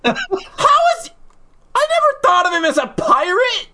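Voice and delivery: very high-pitched disbelief; baffled, voice shooting up high; incredulous outburst. Real, not acted. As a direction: squawk it in total astonishment. Stunned at being told it's pirate.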